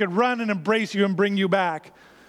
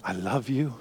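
gentle, intimate and low; God speaking tenderly; 6 semitones lower than usual. Acted. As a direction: speak like a loving father close to his child. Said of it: "But God, your Father, saying".

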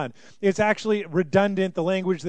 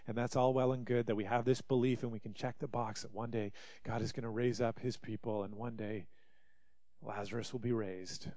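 much softer, lower, resigned voice; Martha voiced softly; 7 semitones lower, 12 dB quieter than usual. Pastor voicing Martha, soft and resigned. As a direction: murmur it like a tired, unconvinced believer.

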